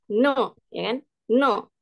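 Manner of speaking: "No" said with a firm falling tone; fourth-tone demo. Shown as a demonstration. Teacher modelling tone four for class, falling "No". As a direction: drop the pitch sharply on "No". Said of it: "nada keempat itu sama dengan bahasa Inggris".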